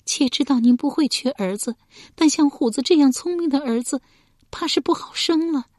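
high, plaintive pleading; submit, yet gently plead for your son; timid high wifely voice; meek, timid. A narrator's reading. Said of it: "诺声说道".

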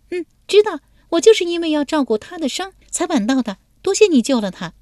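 put-on high, lively girlish voice; young woman's voice. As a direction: play the bold young lady teasing him.

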